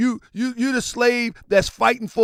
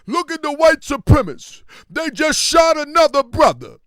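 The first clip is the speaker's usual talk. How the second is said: louder, higher mock outrage; a mock tweeter shouting his outrage; mock outraged tweet; 6 semitones higher, 5 dB louder than usual. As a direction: ham up the indignant online post. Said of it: "They'll give commentary on Twitter".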